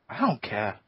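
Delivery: ordinary delivery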